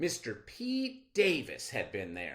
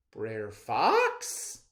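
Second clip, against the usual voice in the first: louder questioning call; trapped rabbit calling to the fox; 8 dB louder than usual. A narrator's reading. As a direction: call out from inside the box. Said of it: "called Bre'r Rabbit".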